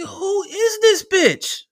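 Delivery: very high, drawn-out squeal of disbelief; stunned disbelief. Retold or imitated. Boyfriend reacting to her rude retort, high incredulous squeal. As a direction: stretch it out slowly in a high, incredulous voice.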